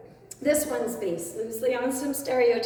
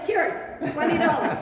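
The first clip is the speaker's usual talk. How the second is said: laughing, a bit louder; laughing punchline; 4 semitones higher, 3 dB louder than usual. Acted. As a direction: deliver the punchline through laughter. Doctor's punchline in joke.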